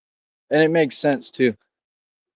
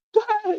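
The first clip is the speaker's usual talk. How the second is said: scoffing laugh; 3 dB quieter than usual. Real, not acted. Scoffs at forty million claim.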